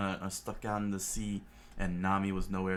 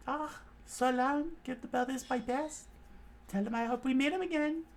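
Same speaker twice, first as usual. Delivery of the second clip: much higher female character voice; high girl voice. Acted. Nami saying goodbye to the brothers, high voice. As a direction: sweetly wave goodbye.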